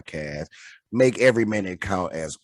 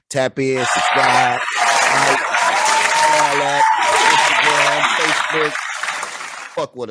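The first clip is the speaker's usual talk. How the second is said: loud, higher hype call-out; hype outro; 5 semitones higher, 6 dB louder, slower than usual. Real, not acted. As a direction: pump up the plugs. Hyped outro plugs.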